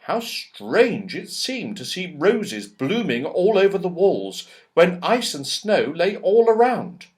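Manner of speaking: ordinary delivery, nothing marked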